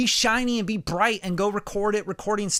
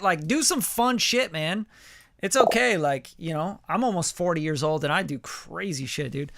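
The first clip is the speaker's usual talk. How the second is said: usual speaking voice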